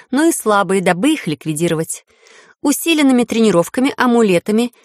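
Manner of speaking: measured official verdict; formal speech. A narrator's reading. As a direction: speak like a judge. Heroine sentences officers.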